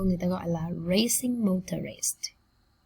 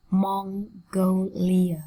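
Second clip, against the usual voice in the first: very slow MON-GO-LIA, syllables apart; much slower than usual. Shown as a demonstration. Teacher modelling a country name, slowly split up. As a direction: sound out Mongolia slowly in separate syllables. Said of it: "Cô giáo đọc chậm nhá".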